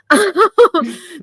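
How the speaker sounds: loud, high burst of laughter. Real, not acted. Host owning up to being worse, loud laugh.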